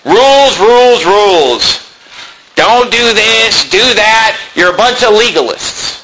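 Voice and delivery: preacher mimicking critics who sneer at God's laws; mocking scoffer. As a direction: parody the scoffers with contempt. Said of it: "that's spoken by people who don't love God".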